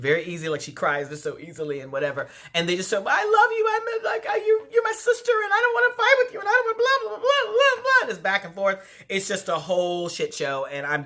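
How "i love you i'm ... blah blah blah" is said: mock teary plea, much higher and louder; mimicking a fake-crying housewife making up with her friend, squeaky and tearful; Ramona's fake crying. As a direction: ham up a whiny fake sob. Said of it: "Ramona starts doing her fake crying".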